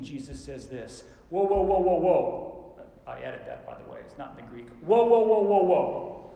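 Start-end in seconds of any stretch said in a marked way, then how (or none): 1.3-2.8 high, very loud stop-everything shout; forceful halting shout. Acted. Jesus stopping his disciples, forceful shout. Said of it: "love to hear the tone of voice that Jesus used". 4.8-6.3 booming, high-pitched string of protest; forceful halting shout. Acted. Jesus stopping his disciples, forceful shout. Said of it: "I think that's exactly how he said it".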